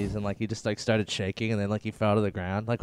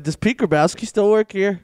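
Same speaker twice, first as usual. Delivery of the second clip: mock prank caller; 8 semitones higher, 6 dB louder than usual. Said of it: "Just say, like".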